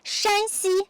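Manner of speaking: "Shanxi" with Shan held high and level; first tone on Shan in "Shanxi". Shown as a demonstration. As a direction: say "Shanxi" with a high, flat, drawn-out first syllable. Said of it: "pronunciation of these two provinces are very tricky".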